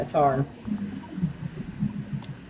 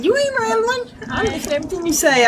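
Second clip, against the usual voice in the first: warm reassurance; 10 semitones higher than usual. Real, not acted. Reassuring a grieving friend.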